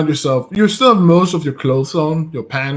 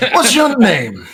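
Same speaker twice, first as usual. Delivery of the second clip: high-pitched demand for a name; demanding question; 9 semitones higher than usual. Acted. Captor first asking the prisoner's name, high and insistent. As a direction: ask it pitched well up.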